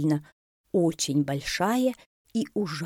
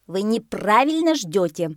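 higher, louder bossy parrot; declaring parrot; 4 semitones higher, 6 dB louder than usual. A narrator's reading. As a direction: declare it with authority. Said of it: "заявил попугай". (категорично заявляющий попугай)

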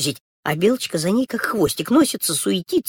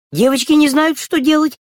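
put-on high girls' voice; 10 semitones higher, 6 dB louder than usual. Girls' part set against kitten.

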